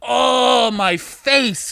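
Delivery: mock drunk whine. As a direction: whimper it like a dazed drunk. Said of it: "she was like".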